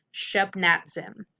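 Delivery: shub, nat, zim run together; blended whole word. Shown as a demonstration. Teacher reading the whole word to students, blended. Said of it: "Whole word".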